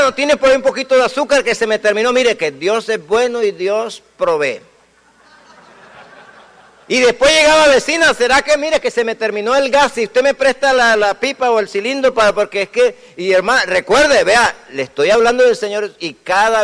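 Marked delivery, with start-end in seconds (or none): 0.0-2.1 lightly acted high, quick request; imitated borrowing neighbor. Believer asks neighbor for sugar, mimicked quick pleading. 7.7-13.0 lightly acted, high-pitched favor-asking; imitated borrowing neighbor. Believer asks to borrow neighbor's gas cylinder, mimicked pleading.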